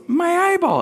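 put-on high-pitched toddler joke voice; comic small-child squeak; Evan. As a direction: squeal it like a little kid repeating his gag. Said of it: "he goes".

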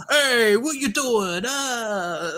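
mocking gang member voice, high and slow. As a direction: ham up a cartoon gang member, drawn out. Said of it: "to actually sound like gang members".

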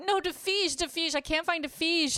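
very high, put-on Brazilian accent; imitated Brazilian accent. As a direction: imitate a Brazilian newcomer pleading in accented English. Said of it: "And she's like".

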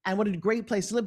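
ordinary talk

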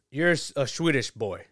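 ordinary delivery

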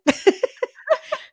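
high burst of laughter; laughing at the closet room. Real, not acted. Laugh after taking closet.

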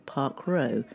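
ordinary talk

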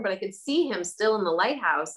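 ordinary talk

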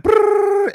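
a very high, loud mock "brrr" noise; hammed-up jittery brrr sound; revved-up brrr. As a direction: buzz it out like you're wired. Take me from going like.

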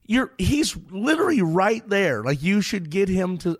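pushy cashier. Retold or imitated. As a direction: lean in and insist. Cashier keeps urging.

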